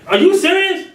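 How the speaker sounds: disciple's stunned high-pitched question; disbelief. Acted. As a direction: react as if it's impossible.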